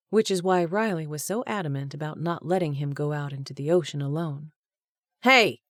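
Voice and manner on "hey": sharp, louder warning bark, higher; angry growl. A narrator's reading. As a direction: snap at him to stop. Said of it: "Tess growled".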